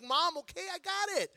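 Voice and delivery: high-pitched mock exasperation; rising, protesting kid's whine; exasperated retort. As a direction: act out a kid fed up with mom. Retells fed-up reply to mom.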